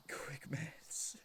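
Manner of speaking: whispered aside. Real, not acted. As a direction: whisper the joke to yourself.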